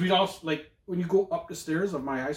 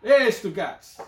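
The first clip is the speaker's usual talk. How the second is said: higher, louder mock Italian hail, Hey stretched; Italian guy calling out; 6 semitones higher, 6 dB louder, much slower than usual. Greeting a friend in the school hallway, mock Italian accent. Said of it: "They always used to walk around".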